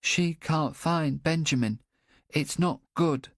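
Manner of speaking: high, slow, cheeks trembling; quivering child, near tears. A narrator's reading. Boy near tears telling the policeman, high and slow. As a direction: hold back tears as the small boy. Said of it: "The boy's cheeks quiver as he replies".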